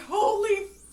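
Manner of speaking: high, loud, drawn-out swearing; hammed-up exasperated yell; exasperated outburst. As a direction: let it burst out after a long wait. Retold outburst after slow escalator.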